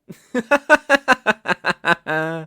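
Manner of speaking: loud high mocking laugh; triumphant evil laughter; gloating villain laugh. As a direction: throw back your head in a cruel cackle. Villain gloats over captive.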